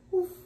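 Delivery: high, yappy woof woof; puppy barking. Acted. Biscuit the puppy barks at the vet, high and yappy. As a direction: yap like a small eager puppy.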